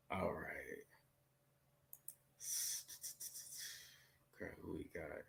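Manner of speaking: whispering to himself; breathy unvoiced aside; whispered aside. Real, not acted. Aside after caller leaves.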